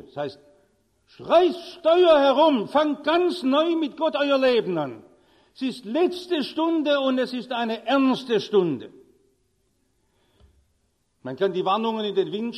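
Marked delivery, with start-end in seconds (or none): 1.2-8.9 fervent preaching. Acted. Preacher urging the congregation to turn back to God, fervent preaching. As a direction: drive the call to repent home.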